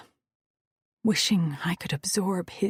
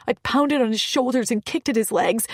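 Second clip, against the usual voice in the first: normal talking voice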